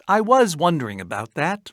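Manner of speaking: ordinary talk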